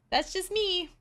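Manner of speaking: high, sing-song smugness; playfully showing off; hammed-up smug king. Dorian's smug quip mocked.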